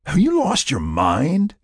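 drawn-out, exasperated question; incredulous demand. A narrator's reading. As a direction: demand an answer in disbelief. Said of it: "he demanded".

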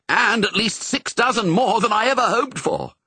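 high, animated character voice; high-pitched, buoyant aside; delighted exclamation. A narrator's reading. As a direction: exclaim it with happy amazement. Overjoyed owner's exclamation in story.

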